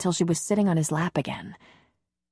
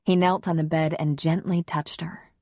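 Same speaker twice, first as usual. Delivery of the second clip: plain reading voice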